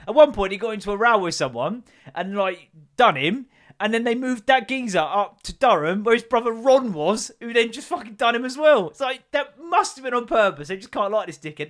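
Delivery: high, loud, worked-up ranting; incredulous rant. Real, not acted. Telling the listeners about the prison transfer, loud incredulous rant.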